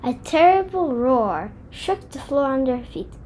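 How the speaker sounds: usual speaking voice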